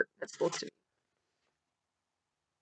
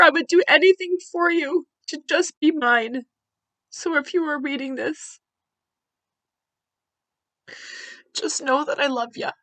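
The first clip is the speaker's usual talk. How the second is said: tearful reading; 6 semitones higher, 6 dB louder than usual. Real, not acted. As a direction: still tearful, easing off towards the end. Reading card while crying.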